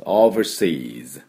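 overseas slowly, with a light R after the V; slight R. Shown as a demonstration. Teacher showing the R slips in, slow model pronunciation. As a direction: colour the middle of overseas with a little R. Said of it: "Rがちょっと入ってます".

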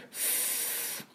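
ふーっ unvoiced hiss; voiceless f. Shown as a demonstration. Teacher demonstrating f without throat voice, unvoiced hiss. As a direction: breathe out an f with no voice. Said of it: "喉に音を出さないで".

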